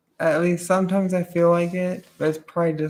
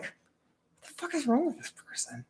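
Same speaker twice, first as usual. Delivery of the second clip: high and much softer incredulous aside; disbelief; 9 semitones higher, 12 dB quieter than usual. Retold or imitated. Watching the bad trick, a baffled onlooker asks herself quietly. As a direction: mutter it under your breath in disbelief. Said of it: "And then you're just like".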